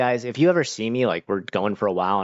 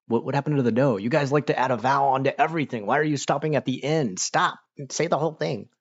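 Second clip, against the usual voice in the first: higher-pitched pleading complaint; comic nagging, pitched up; mock exasperation; 5 semitones higher than usual. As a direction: protest in playful exasperation, pitch climbing. Mock complaint to Japanese speakers.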